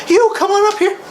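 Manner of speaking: high, breezy dismissive call; mock bored host. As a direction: wave them off like you don't care. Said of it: "and goes".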